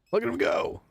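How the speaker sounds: squeaky proud cheer for her horse; excited cheer. Real, not acted.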